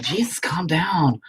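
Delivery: high, drawn-out mock plea; imitated exasperated viewer. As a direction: act out a viewer begging for calm, slow and high. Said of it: "People are like".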